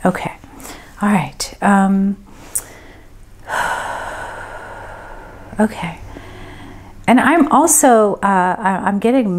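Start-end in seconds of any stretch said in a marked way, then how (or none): 3.5-5.2 long, quiet exhale; drawn-out sigh while concentrating. Real, not acted. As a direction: breathe out softly and slowly. Medium pausing between impressions.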